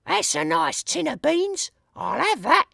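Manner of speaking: very high, sing-song woman thief; a greedy lady coveting loot; burglar lady voice. A narrator's reading. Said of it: "The voice says".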